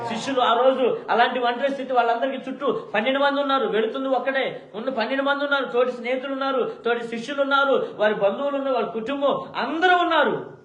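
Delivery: high, loud preaching at full force; impassioned pulpit call; fervent preaching. Acted. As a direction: drive it home to the congregation.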